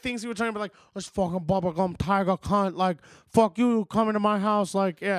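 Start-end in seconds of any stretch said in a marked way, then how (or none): 0.9-4.7 hammed-up gangster tirade; Scarface. Friend recalling made-up movie lines, Scarface impression. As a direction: curse like a mock Cuban drug lord. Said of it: "make up, like, things".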